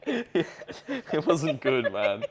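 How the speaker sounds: can barely get it out for laughing; real laughter bubbling through the words; laughing hard. Real, not acted. Laughing through crude story.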